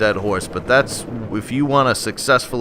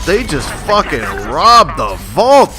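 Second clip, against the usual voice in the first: outraged; 4 semitones higher, 5 dB louder, slower than usual. Real, not acted. Vault robbed, commentator upset.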